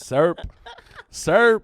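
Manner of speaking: "syrup" as loud questioning "surp"; mock-baffled one-syllable "surp"; mimicked regional syrup. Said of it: "they say syrup".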